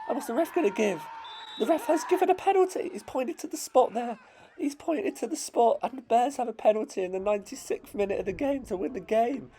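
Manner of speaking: high, breaking excited commentary with laughs; real commentator losing it over a late penalty; voice cracking with excitement. Real, not acted. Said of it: "My voice is cracking up".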